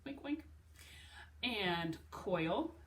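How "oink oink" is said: light, higher playful pig oink. Shown as a demonstration. Teacher playfully oinking like a pig for students. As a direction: squeal a cheerful little oink. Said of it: "like a pig".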